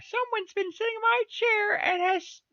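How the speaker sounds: very high, squeaky tiny bear; little small wee voice. A narrator's reading. Wee bear finds his chair broken, very high squeak. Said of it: "in his little small wee voice".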